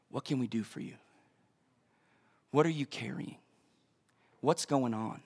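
very soft, low, caring questions; gentle counsellor. Retold or imitated. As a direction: invite someone to open up, barely above a murmur. Voices Jack gently counselling someone.

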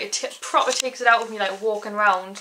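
ordinary talk, nothing marked

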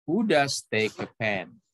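slow question, pitch falls away at the end on pen; falling intonation. Shown as a demonstration. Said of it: "intonasinya turun".

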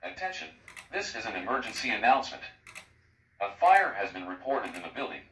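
ordinary talk, nothing marked

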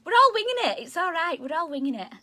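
squeaky high, bright reassurance; cheery reassurance. Real, not acted. Teacher chirpily reassuring pupils we're all winging it. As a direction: tell them it's fine, chirpily.

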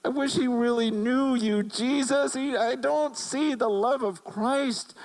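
high, impassioned plea to the Lord, imitating; retold tearful lament. Retelling a crying pastor's lament over leaders, imitated anguish. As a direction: plead as the weeping pastor, pitch held high. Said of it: "He's crying and going".

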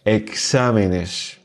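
"Exámenes" slowly, stretched on XA; slow right down and stress XA in "Exámenes"; accent sur le A. Shown as a demonstration. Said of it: "mettre un accent écrit sur le A". (« Exámenes » lentement, accentué sur XA)

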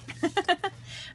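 high, light little laugh; short giggle. Real, not acted. Vlogger joking about the costume everyone wants, high giggle. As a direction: giggle briefly at your own remark.

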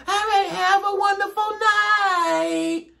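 high, slow, overly sweet farewell; sing-song sugary sign-off; sweet goodbye. Retold or imitated. Telling the caller goodnight, mock sweet and high.

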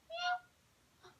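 high mock meow; cat meow. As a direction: mew like a cat to tease. Imitating a cat for dog.

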